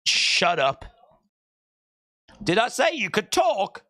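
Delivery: bossy snapped orders, lightly acted; harsh barking commands. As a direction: bark the orders like a bully. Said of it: "And then you go".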